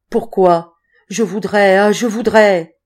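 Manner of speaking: loud, longing protest; passionate yearning. A narrator's reading. Boy answering her order to be silent, passionate yearning.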